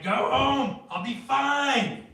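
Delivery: high, loud insistence; drunk grandma insisting. Retold or imitated. Drunk grandma refusing help from her grandson, high loud insistence. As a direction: brush them off like a stubborn tipsy granny. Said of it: "And Grandma's like".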